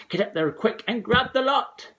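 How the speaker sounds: high, loud order; high bossy mother. A narrator's reading. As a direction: bark it at the boy.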